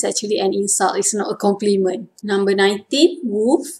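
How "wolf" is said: ordinary talk, nothing marked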